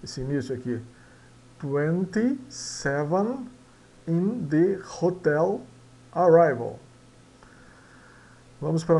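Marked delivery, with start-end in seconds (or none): 1.6-6.8 "Twenty-seven in the hotel arrival" very slowly and clearly; teacher rereading the sentence slowly; slow model reading. Shown as a demonstration. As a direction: read it slowly for the class to repeat. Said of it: "vamos repetir".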